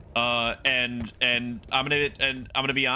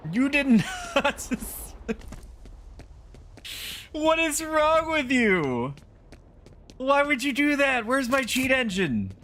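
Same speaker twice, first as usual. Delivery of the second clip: outraged squeal; 14 semitones higher, slower than usual. Real, not acted. Streamer wailing at the game for sending him back, high and outraged. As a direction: wail at the screen in disbelief. Game restarts his level.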